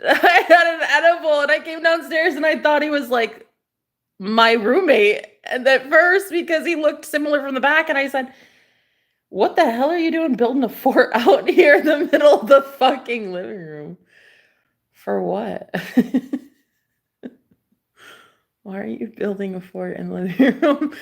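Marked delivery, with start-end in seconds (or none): 0.0-7.5 giggly, high, laughing confession; real amused laughter through the words. Real, not acted. 9.3-20.9 laughing through the quote, pitch raised; amused retold question, giggling. Retelling confusion while high.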